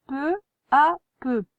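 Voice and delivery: "Peu à peu" slowed and lifted; teacher repeating a dictation word. Shown as a demonstration. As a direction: draw the words out for the pupil. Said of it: "n'oublie pas".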